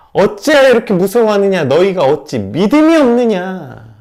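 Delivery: loud stern rebuke, slowing at the end. Acted. Jesus rebuking frightened disciples, loud stern rebuke. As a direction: scold them like Jesus rebuking the fearful. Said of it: "예수님의 이 꾸짖으심".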